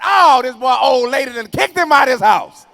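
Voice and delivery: high, loud mocking shout; mock him loudly like dad; father mocking.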